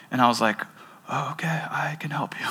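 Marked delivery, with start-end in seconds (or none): 1.1-2.5 hushed, breathy whisper to a stranger; barely voiced, gentle offer of help. Pastor whispering an offer of help to sobbing woman. Retelling his reply to crying woman.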